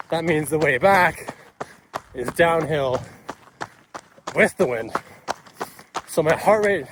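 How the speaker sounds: talking while running, loud and high. Real, not acted. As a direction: speak mid-stride, pushing over wind. Talking mid-run, mile seven.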